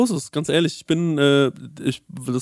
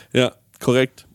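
ordinary delivery

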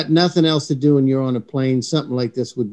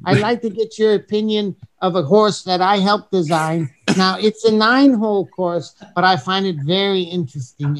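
higher, mock European accent as Heinz; Heinz's accent; 4 semitones higher than usual. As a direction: put on Heinz's accent, politely chatty. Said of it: "the whole entire show in Heinz's accent".

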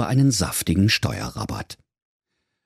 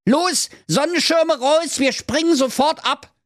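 commanding mother goat. Acted. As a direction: bark it like a tipsy mum commanding.

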